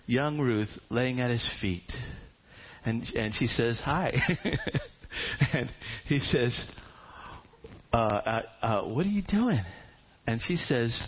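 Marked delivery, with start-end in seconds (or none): 3.8-4.5 shy giggle. Acted. Ruth greets Boaz shyly. 7.9-9.6 startled. Acted. Boaz woken, startled.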